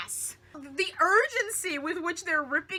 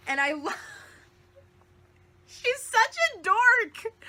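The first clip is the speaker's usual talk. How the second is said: laughing; 6 semitones higher than usual. Real, not acted. Laughing affectionately.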